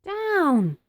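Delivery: high, falling cry of command; witch crying out. A narrator's reading. Witch orders broomstick down after losing hat, crying out. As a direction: cry it out high, letting the pitch drop. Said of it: "cried the witch".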